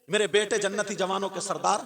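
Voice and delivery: normal talking voice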